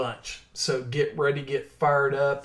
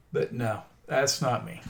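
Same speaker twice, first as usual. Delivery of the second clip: ordinary talk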